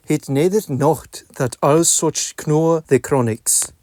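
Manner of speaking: reading in reconstructed Middle English pronunciation; old vowels, 'nations' as nasjɔnz, 'out' as ut. Shown as a demonstration. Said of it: "I shall now begin reading Dialogue Between a Lord and a Clerk".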